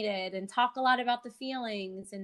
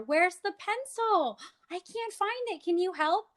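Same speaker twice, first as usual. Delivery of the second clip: higher, slow playful searching; animated reading to toddler; 9 semitones higher than usual. Shown as a demonstration. Therapist demoing book talk for a toddler, animated. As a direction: act puzzled to draw the child in. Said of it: "turn it into an interactive experience".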